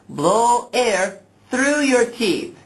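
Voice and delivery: slow exaggerated speech modeling. Shown as a demonstration. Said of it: "like this".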